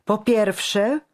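high, loud, tightly held temper; barely restrained anger. A narrator's reading. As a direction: hold back your fury with difficulty. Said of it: "rzekł z trudnością się hamując".

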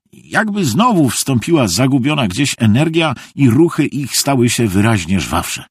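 ordinary delivery

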